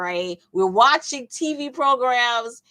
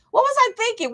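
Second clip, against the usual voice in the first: high-pitched self-questioning; acted high-pitched disbelief; mock self-reproach; 13 semitones higher, faster than usual. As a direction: scold yourself in a high, exaggerated voice. Acts out scolding oneself.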